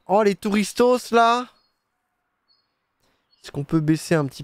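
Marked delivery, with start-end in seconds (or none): 0.1-1.5 mock excited. Jokey name for the tourists.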